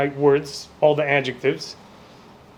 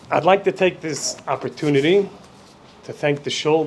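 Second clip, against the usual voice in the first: ordinary delivery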